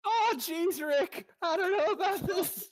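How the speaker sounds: high, nervous stammering whine; Morty voice. Acted. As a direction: panicky Morty from Rick and Morty. Morty impression voicing Fyodor.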